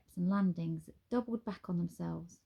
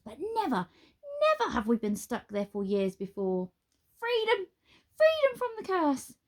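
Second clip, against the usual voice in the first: high, loud, theatrical wizard voice; a giddy wizard savouring his freedom; excited wizard; 6 semitones higher, 4 dB louder than usual. A narrator's reading. Wizard to Nine, exultant and high.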